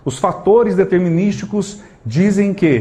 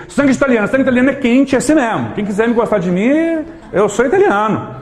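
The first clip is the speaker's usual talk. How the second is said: playful mock boast; 6 semitones higher than usual. Jokes about his hot Italian blood.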